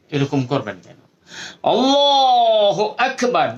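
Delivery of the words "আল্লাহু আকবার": আল্লাহু আকবার lifted high and stretched slow; full-mouthed takbir. Shown as a demonstration. Teacher modeling the proper full-throated takbir. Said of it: "দেখবেন বড় বড় ইমাম সাহেবরা".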